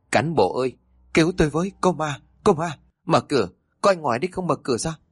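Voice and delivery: high, frantic cries for help, rushing at the end; terrified men calling for the guard; panicked cry. A narrator's reading. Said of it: "Chúng hốt hoảng kêu lên".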